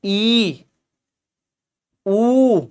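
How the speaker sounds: normal talking voice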